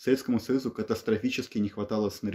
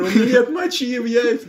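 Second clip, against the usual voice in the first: acted quote; 12 semitones higher, 7 dB louder than usual. Acting the orderly's line.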